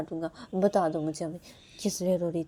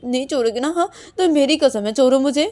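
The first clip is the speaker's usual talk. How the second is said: loud, pitched-up demand; insistent ultimatum; 6 semitones higher, 10 dB louder than usual. Acted. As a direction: force the release with a raised voice. Ultimatum with an oath. (ज़ोर देकर ऊँची आवाज़ में धमकी)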